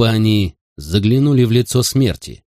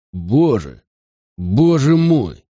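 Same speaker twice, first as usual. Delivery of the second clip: horrified exclamation; 3 semitones higher, much slower than usual. A narrator's reading. Wayne staring at the body, horrified exclamation. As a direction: stretch it out in stunned horror.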